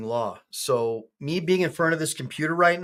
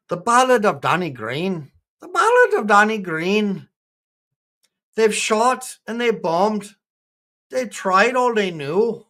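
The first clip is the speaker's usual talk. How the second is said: higher, measured recital in an attempted Irish accent; 5 semitones higher than usual. Streamer reciting a friend's ballad to chat, attempted Irish accent. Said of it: "I'm trying to talk Irish".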